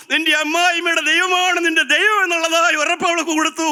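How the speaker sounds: high-pitched, impassioned pulpit cry; full-force preaching. Real, not acted. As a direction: preach it high and relentless, driving each phrase to the congregation. (ഉയർന്ന സ്വരത്തിൽ ആവേശത്തോടെയുള്ള പ്രസംഗം)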